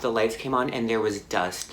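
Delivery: ordinary talk, nothing marked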